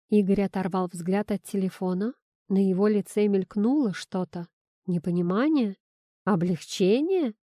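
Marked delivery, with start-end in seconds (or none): none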